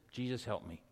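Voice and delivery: soft, low plea; simple prayer. Shown as a demonstration. Preacher models the simplest prayer to Jesus, soft plea.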